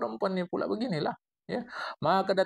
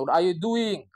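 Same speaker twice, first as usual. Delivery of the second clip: English version; 5 dB louder, slower than usual. Retold or imitated. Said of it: "kalau bahasa Inggrisnya".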